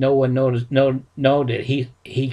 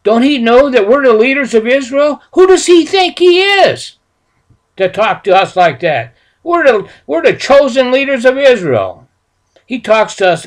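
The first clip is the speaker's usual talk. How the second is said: much higher, louder, outraged; furious yelling; 10 semitones higher, 8 dB louder than usual. Retold or imitated. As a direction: shout down the insult. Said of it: "They were just mad".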